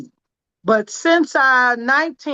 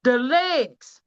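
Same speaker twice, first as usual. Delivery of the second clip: ordinary talk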